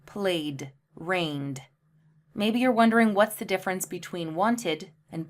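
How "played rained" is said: played and rained, lower, soft d at the end; played, rained, one syllable. Shown as a demonstration. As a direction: model the d sound. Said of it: "just a D sound".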